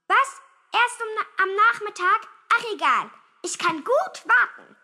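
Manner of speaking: put-on high, loud childish protest; defiant, piping boy voice; indignant little boy. A narrator's reading. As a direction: protest loudly as a miffed kid. Boy outraged, then feigns patience.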